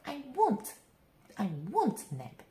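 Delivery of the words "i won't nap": plain reading voice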